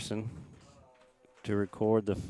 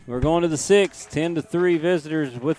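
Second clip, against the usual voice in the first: excited call; 6 semitones higher, 6 dB louder than usual. Real, not acted. Inning-ending call.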